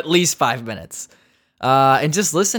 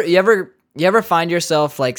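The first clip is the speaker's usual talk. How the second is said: normal talking voice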